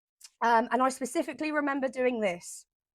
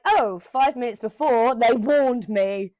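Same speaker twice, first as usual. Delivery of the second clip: realization; 6 dB louder than usual. Retold or imitated. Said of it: "I'm like".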